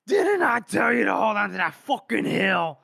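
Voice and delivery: high, loud chewing-out shout; acted shouted reprimand; really loud yell. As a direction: bellow it like a furious chief. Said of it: "Really loud".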